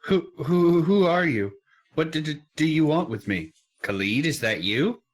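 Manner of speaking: stammering scared potter. Acted. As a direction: stammer like a terrified villager. Stammering frightened potter voiced.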